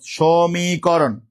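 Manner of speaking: সমীকরণ stretched very slowly syllable by syllable; drawn-out word. Shown as a demonstration. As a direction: draw out each syllable of সমীকরণ for the class. Teacher stretches out the answer. (সমীকরণ টেনে টেনে ধীরে বলা)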